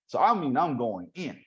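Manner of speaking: ordinary delivery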